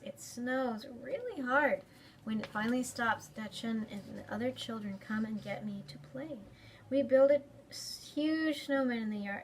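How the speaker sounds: put-on lively read-aloud for kids; story time reading. A narrator's reading. Librarian reading a picture book to children, lively. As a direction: read it brightly to a young audience.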